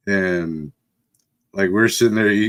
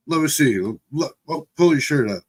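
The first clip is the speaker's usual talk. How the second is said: higher, urgent parental demand; imitated insistent parent; 5 semitones higher than usual. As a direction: play a parent checking a hurt kid. Parents demanding to see injury.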